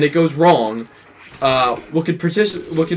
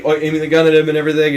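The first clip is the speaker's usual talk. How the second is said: normal talking voice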